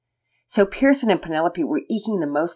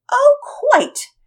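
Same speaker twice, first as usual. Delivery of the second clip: very high, airy posh lady; trite posh reply; 7 dB louder than usual. Acted. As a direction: toss it off like a grand lady. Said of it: "Penelope answered back tritely".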